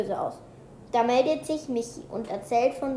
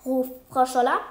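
ordinary delivery

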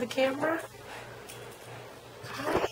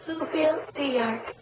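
slower questioning; 5 semitones higher, much slower than usual. Shown as a demonstration. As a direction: ask it again very slowly. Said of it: "Noah, Noah".

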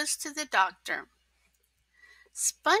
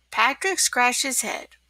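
ordinary delivery

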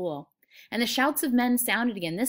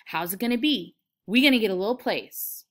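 loud, eager anticipation; childlike, louder enthusiasm; excited prompting; 2 semitones higher, 8 dB louder than usual. Acted. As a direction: chime in keenly with the dream. Lenny excited about the dream.

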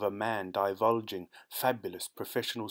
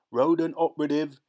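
louder, higher working-class character voice; rat catcher; 6 semitones higher, 7 dB louder than usual. Acted. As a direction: announce the job title with force. Rat catcher introduces himself.

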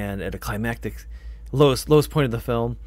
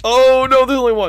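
much higher and louder panic; alarmed cry; 12 dB louder than usual. Real, not acted. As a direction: yelp at the danger. Tense game moment.

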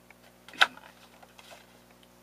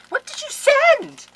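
very high, loud, drawn-out demand; slow, high squeal of amazement; astonished shriek; 12 dB louder, slower than usual. Real, not acted. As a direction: shriek it in disbelief. Astonished on opening Beth's gift.

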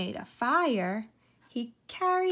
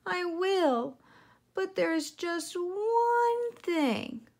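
high, slow little piglet voice; a small pig playing along, then hinting; sly piglet; 9 semitones higher, much slower than usual. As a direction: piglet voice, coy and drawn out. Piglet voice in story.

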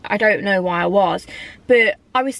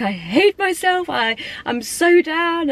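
higher, slow, half-wailed self-pity; retold sobbing; 8 semitones higher, slower than usual. Vlogger retells crumbling in her car, mock tearful wail. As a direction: imitate crying in the car, drawn out. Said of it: "I was sobbing".

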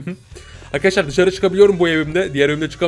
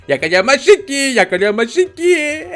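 put-on high, sing-song baby teasing; childish taunt; 7 semitones higher, slower than usual. Baby daring dad to catch them, high sing-song taunt. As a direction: taunt like a toddler, stretching the last word.

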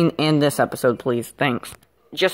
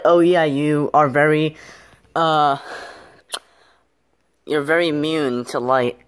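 usual speaking voice

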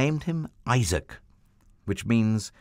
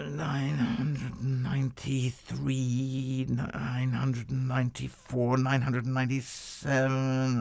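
put-on slow, higher, careful counting voice of old Abraham; a slow, muttered count under the night sky, higher than the narrator; Abraham counting stars; 5 semitones higher, 3 dB quieter, slower than usual. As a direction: drag out each number like you might lose track. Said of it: "he kept losing count".

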